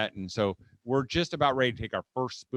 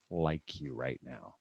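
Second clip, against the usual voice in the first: low, soft, slow and deliberate; grave disapproval; 11 semitones lower, 10 dB quieter, slower than usual. Acted. Parent's gesture speaking to a child, cold disapproval. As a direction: let it drop heavily and quietly.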